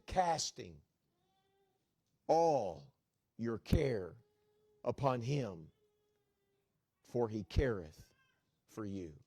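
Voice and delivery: slow, measured verse with long pauses; solemn scripture reading. Acted. Preacher reciting Peter's verse to the congregation, solemn and measured. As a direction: set each phrase apart and let it sink in.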